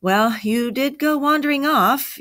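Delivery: high-pitched call back; yelling back. A narrator's reading. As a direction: yell it back across a distance. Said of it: "yelled back Jack".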